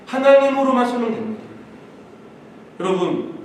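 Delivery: normal talking voice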